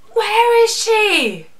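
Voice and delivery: high, loud, drawn-out wail; exasperated fan cry. Pretend. Mock demand about missing character.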